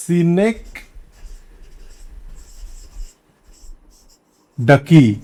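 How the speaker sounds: "synecdoche" said si-NEK-duh-kee, very slowly in separated pieces; careful pronunciation. Shown as a demonstration. Teacher introducing the term, "synecdoche" sounded out slowly as si-nek-duh-kee. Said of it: "উচ্চারণটা কিন্তু".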